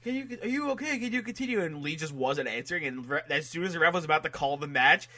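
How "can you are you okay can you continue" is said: higher-pitched, urgent checking voice; concerned referee. Retold or imitated. Referee asking a downed wrestler if he can continue, high concern. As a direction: imitate a worried ref checking on someone.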